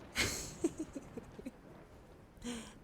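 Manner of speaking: light, trailing giggle; laughing. Real, not acted. Streamer amused by the future gaming talk, giggling. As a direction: let out a breathy chuckle.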